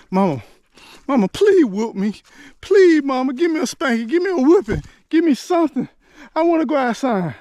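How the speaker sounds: high, loud begging to be spanked instead; desperate pleading. Retold or imitated. Child begging his mama for a whipping, high and desperate. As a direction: plead like a kid in tears. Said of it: "I'm just catching a fit".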